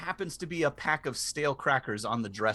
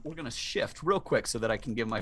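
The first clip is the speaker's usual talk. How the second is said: ordinary talk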